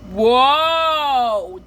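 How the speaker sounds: long, high, falling whoa; the Bubble Guppies riding up, a long whoa; group whoa. Retold or imitated. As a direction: ride the drop with a long whoa. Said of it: "Bubble Guppies and Mister Grouper says".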